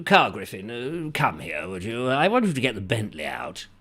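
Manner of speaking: lightly voiced posh employer; a hint of an aristocrat summoning staff; posh lord. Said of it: "when Lord Jeffrey out there".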